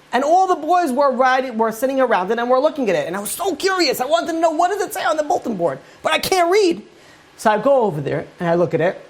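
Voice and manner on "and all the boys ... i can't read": much higher, eager, wondering voice; a kid dying to know what it says; curious boy. Retold or imitated. Retells his curious boyhood self.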